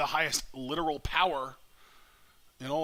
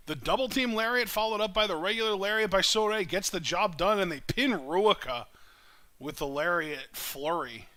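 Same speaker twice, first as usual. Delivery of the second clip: lively live play-by-play call; wrestling commentator; 3 semitones higher, 4 dB louder than usual. Real, not acted. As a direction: call the match as it happens.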